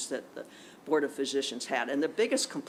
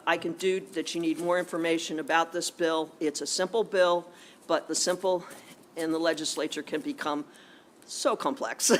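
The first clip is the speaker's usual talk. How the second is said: ordinary talk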